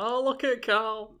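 high, slow mocking singsong; imitating friends' drawn-out tease; teasing coo. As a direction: draw it out high, poking fun at a mate. Imagining friends teasing Carl.

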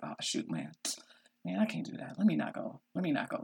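low, soft grumble to oneself; reluctant backing out. Retold or imitated. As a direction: talk yourself out of it under your breath. Acts out talking self out of trips.

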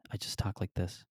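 very soft, subdued mock-enlightened voice; soft-spoken imitation. Comic playing the newly enlightened guy, hushed imitation. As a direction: imitate him barely raising your voice. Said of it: "And he talks like this".

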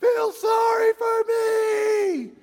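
put-on slow, high and loud crying kid's wail; dad mimics his crying son begging his mother for pity; whiny child imitation. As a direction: imitate a sobbing child's demand. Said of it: "while he's crying".

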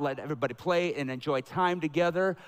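ordinary talk

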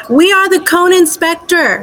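over-pronounce each word like the listener is deaf; mock slow yelling. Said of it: "Why are you yelling at me?".

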